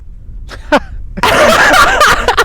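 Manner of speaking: high, loud burst of laughter; big real laugh. Real, not acted. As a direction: break up laughing. Laughing at his story.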